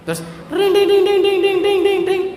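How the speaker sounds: high loud ringing bell noise; bell imitation. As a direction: ring it out like a vendor's bell. Imitating ice cream bell.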